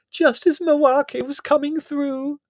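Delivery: a loud, high angry outburst. Acted. Margot rounding on Prue for ruining the séance, loud and angry. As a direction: lash out at her, shrill and furious. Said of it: "said Margot angrily".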